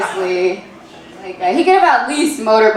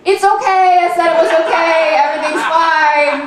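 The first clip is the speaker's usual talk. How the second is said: high, strained mock shout; mock yelling back; 6 semitones higher than usual. Woman yelling back mostly for the neighbors, mock shout. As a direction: holler reassurance in a raised, pitched-up voice. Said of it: "I start yelling back".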